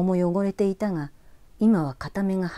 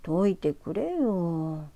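coaxing voice; much slower than usual. Acted. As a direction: sweet-talk to be untied. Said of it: "男の子は猫なで声を出した". (猫なで声)

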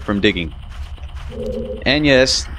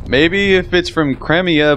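usual speaking voice

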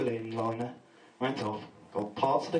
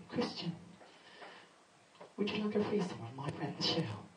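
put-on quiet, coy girlfriend; soft girlfriend voice; 3 semitones higher, 8 dB quieter than usual. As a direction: offer it softly and sweetly.

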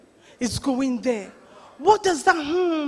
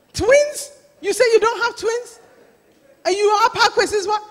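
high, loud incredulous questioning; mock disbelief; 7 semitones higher, 5 dB louder than usual. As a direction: act out an auntie who cannot believe it. Mimics auntie's disbelief.

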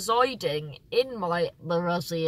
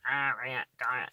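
"Harriet Dyer" lower and softer, Cornish style with H dropped; Cornish accent; 7 semitones lower, 5 dB quieter than usual. Shown as a demonstration. Introducing her name as Cornwall says it, Cornish accent. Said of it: "That's how they say it".

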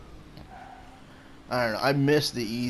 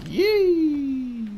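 squealed, drawn-out "yee"; high whoop; 12 semitones higher, 4 dB louder than usual. Real, not acted. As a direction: whoop way up high and let it fall. Exclaiming during play.

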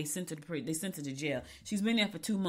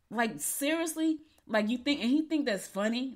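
incredulous disbelief; 6 semitones higher than usual. Real, not acted. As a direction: let the disbelief stretch the word out, pitched up. Indignant reaction to host's joke.

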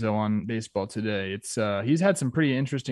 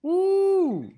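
excited whoop; 3 dB louder than usual. Real, not acted. Cheering a player's name.

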